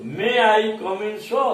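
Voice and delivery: slow request ending on a high sir; rising intonation. Shown as a demonstration. Teacher shows students the rise on sir. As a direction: lift the voice up on sir. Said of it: "स पर जाते जाते हमारा उच्चारण".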